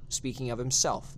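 ordinary delivery

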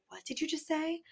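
high, hushed disbelief; incredulous retort. Retold or imitated. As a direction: ask it softly and high, taken aback by the accusation. Retold argument, taken aback.

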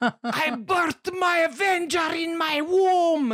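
a wildly exaggerated foreign-queen accent; Angelina Jolie's accent. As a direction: overact a wild mock accent. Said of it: "she takes on this accent".

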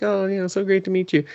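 high, quick, gushy hello; hammed-up high-pitched politeness; mock eager greeting. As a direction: imitate someone overeager to meet. Acted polite greeting to brother.

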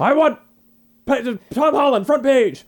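high, loud editor's bark; barking newspaper editor. Retold or imitated. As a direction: demand the front page like an angry boss. Continues Jameson impression.

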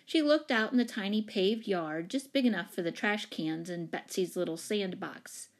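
usual speaking voice

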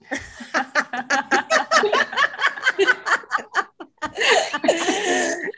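long high burst of laughter. Real, not acted. Reacts to the old pot question.

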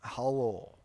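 toned-down Russian hello. Acted. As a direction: bring the mock-Russian greeting down. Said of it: "we have to go down".

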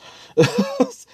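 a loud, high cackle breaking out; high shrieking laugh. Real, not acted. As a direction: burst out laughing at his own point. Laughing at thugs having feelings.

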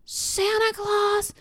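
high, slow, drawn-out proclamation; little boy's grand announcement. Retold or imitated. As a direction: imitate a child proclaiming it to the whole room. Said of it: "And he goes".

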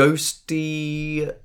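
ordinary delivery

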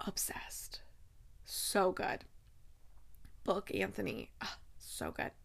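very soft, half-whispered rapture; hushed swooning. Real, not acted. Fan overcome by a favorite book, hushed adoration. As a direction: trail into an awed near-whisper.

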